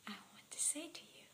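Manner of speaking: tender whisper. Real, not acted. Leaving the audience with a thought, softly whispered. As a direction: confide it softly to each listener.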